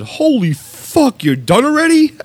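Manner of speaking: high, drawn-out amazed yell; shocked disbelief; surprised shout. Retold or imitated. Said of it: "He said".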